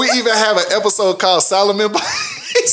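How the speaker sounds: high, loud words through laughter; laughing. Real, not acted. Host cracking up recalling the Solomon Bites episode, laughing. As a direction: squeeze it out while cracking up.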